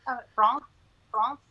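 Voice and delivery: "France" with a nasal aw vowel; trying the name. Shown as a demonstration. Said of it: "the A is pronounced as in war".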